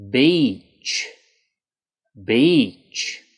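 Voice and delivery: beach with a long stretched ee. Shown as a demonstration. Teacher modelling a long ee for learners. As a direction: draw out the ee in "Beach". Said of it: "make sure in this word that you really lengthen that E sound".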